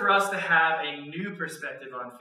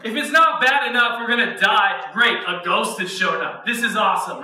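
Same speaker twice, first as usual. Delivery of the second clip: panicked disciples; 3 semitones higher, 6 dB louder than usual. Acted. Panicked disciples voiced in story.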